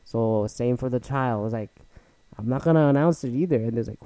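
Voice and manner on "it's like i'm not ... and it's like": ordinary delivery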